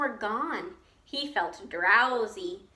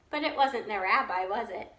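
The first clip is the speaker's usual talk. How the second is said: plain reading voice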